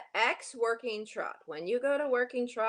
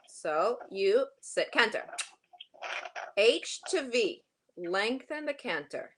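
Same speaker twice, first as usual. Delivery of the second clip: normal talking voice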